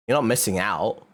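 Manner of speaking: normal talking voice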